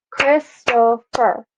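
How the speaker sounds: Christopher split into three separate beats, Chris-to-pher; syllable by syllable. Shown as a demonstration. Teacher clapping a full name, Christopher syllable by syllable. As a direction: clap out Christopher, a gap after each syllable. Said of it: "we clapped three times".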